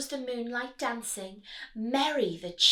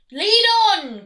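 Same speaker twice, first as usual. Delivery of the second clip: high, loud eager shout; rat cries out; 9 semitones higher, 13 dB louder than usual. Acted. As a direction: bark out the order. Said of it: "cried the rat".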